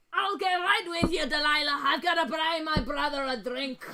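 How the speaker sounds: hearty Scottish-sounding dwarf voice; lightly Scottish dwarf. Acted. Dwarf excusing himself to Delilah for a drink, hearty. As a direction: call it out as a genial dwarf.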